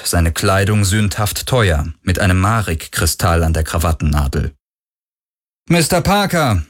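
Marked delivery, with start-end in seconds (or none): 5.7-6.6 high-pitched hail without cordiality; raised, reserved greeting; cool call. A narrator's reading. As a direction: call out his name, no great friendliness. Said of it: "rief Galen ohne große Herzlichkeit".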